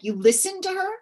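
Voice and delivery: high, disbelieving rise on listened; incredulous question. Retold or imitated. Friend asks in disbelief, high incredulous question. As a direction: echo a friend's shock that she took a kid's advice. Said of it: "people are like".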